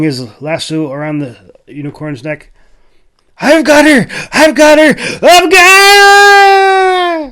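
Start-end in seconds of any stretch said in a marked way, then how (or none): 3.4-7.3 very high, very loud, last one held long; triumphant yell. Acted. Jack yelling as he lassos the unicorn, triumphant. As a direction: scream it in triumph.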